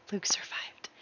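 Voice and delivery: sighing, whispered relief; relieved whisper. Real, not acted. Player relieved her Sim Luke survived, whispering. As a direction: let the breath out. Relief after tense reveal.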